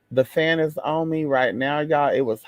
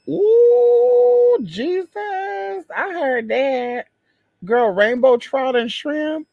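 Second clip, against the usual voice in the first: high excited ooh, then a slow drawn-out I heard that; thrilled squeal; 11 semitones higher, 5 dB louder, much slower than usual. Real, not acted. Reading the menu aloud, high delighted squeal. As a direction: squeal over the food like it's heaven.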